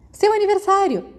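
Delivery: sudden high excited outburst; bursting out. A narrator's reading. Peter bursting out to his friends, excited. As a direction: blurt it out, thrilled. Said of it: "Peter explodiu de repente".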